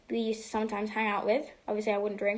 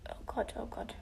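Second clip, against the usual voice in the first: whispered; 9 dB quieter than usual. Real, not acted. Whispered aside.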